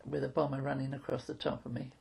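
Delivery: ordinary talk, nothing marked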